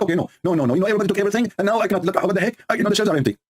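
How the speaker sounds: high-pitched exasperated refusal; put-on indignant complaining; mock protest. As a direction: refuse in a high, hammed-up fluster. Abdul refusing and protesting to God.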